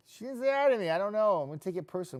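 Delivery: high, loud playful complaint; mock indignation. Host joking that he was snubbed, mock upset. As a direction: protest like a wounded child, not seriously.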